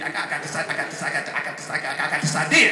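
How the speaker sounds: nervous stutter, repeated words. Retold or imitated. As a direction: stammer it out like you're nervous. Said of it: "He's stuttering 'cause he's nervous".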